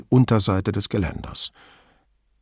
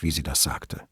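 ordinary delivery, nothing marked

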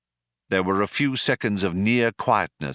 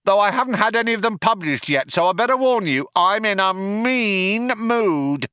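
other cop chiming in; 11 semitones higher than usual. A narrator's reading. Second cop warns the cornered group, high calling voice. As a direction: call it out high, stretching the threat. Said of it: "chimed in the other cop".

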